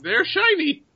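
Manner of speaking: very high creature voice; bird creature. Acted. Bird leader explains its treasure to adventurer, high creature voice.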